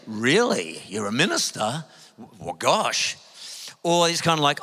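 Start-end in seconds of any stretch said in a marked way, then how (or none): 0.1-3.2 lower, impressed "really, gosh"; mock surprise. Stranger learning he's a minister, lightly mocked surprise. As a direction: play a stranger taken aback. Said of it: "sometimes people say".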